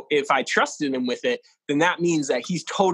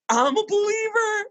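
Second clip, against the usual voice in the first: amazed manager. Retold or imitated. Manager reacts to the story.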